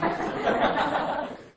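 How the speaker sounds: breathy high nervous laugh, quick and falling. Real, not acted. A speaker facing a crowd without slides, nervous laugh. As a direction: laugh it off nervously before the audience.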